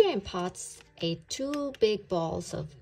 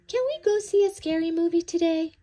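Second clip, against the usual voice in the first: high, loud and fast eager question; little bunny begging to see the movie; excited kid asking; 9 semitones higher, 8 dB louder, much faster than usual. A narrator's reading. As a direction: blurt it out, bouncing with excitement. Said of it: "P J asked".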